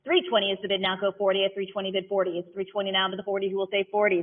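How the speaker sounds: rhythmic auctioneer chant, numbers rolled out; auction bid calling. Acted. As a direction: call the bids like an auctioneer.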